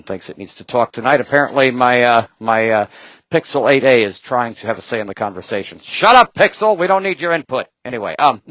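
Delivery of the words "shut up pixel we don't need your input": sharp, higher, louder telling off; scolding a chatty phone, half joking; mock scold. Real, not acted.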